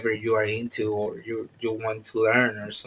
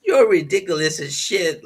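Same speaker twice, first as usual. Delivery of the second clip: shouting; 3 semitones higher, 6 dB louder than usual. Real, not acted. Yelling at the young ones.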